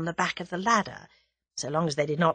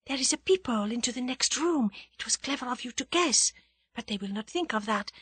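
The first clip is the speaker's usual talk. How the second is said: soft, higher hushed voice; whispering on; 4 semitones higher, 6 dB quieter than usual. A narrator's reading. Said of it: "Annette explained in a whisper".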